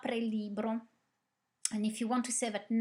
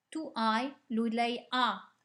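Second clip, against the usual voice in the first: ordinary talk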